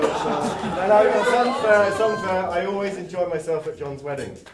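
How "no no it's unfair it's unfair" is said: louder, slow mock complaint; teased guest playfully protesting that it's unfair. Real, not acted.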